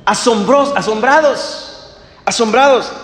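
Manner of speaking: amazed. Acted. Preacher stresses their astonishment.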